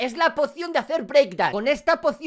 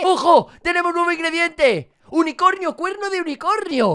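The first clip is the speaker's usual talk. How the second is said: excited announcement; 6 semitones higher than usual. Real, not acted. New ingredient unlocked.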